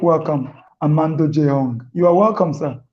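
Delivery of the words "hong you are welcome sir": normal talking voice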